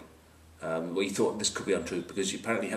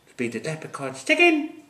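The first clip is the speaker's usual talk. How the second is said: higher, louder Irish leprechaun voice; mock Irish leprechaun; 6 semitones higher, 5 dB louder than usual. Pundit teasing the Irish team, mock Irish accent. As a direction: put on a comic Irish accent.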